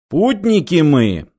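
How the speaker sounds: slow and humble. Acted. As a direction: say it meekly, bowing. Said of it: "смиренно произнёс снайпер".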